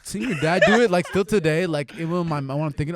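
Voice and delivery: excited. Real, not acted. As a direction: relive the thrill of it. Excited recollection of windmill.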